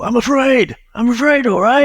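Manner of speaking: high, strained outburst, acted out; Rocky. Imitating the boxer's confession from the film for a co-host, high-pitched outburst. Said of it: "I had Rocky three vibes".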